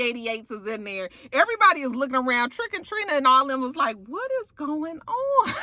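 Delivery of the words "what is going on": high, stretched-out mock disbelief; imitated baffled squeal; mimicked bewilderment. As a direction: draw it out high like stunned onlookers.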